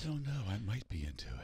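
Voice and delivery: soft ASMR whisper; breathy close-mic whisper. Shown as a demonstration. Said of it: "Can we get a taste?".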